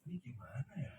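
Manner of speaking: quiet, low dismay, recounted; retold heartbreak. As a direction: murmur it like your past self at a loss. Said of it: "hancur itu hati". (menirukan hati hancur, pelan)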